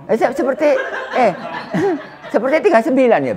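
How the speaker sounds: high giggling words breaking into laughter; laughing. Real, not acted. Preacher laughing at the congregation's age mix-up, high giggling speech. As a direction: laugh through it, pitch jumping up.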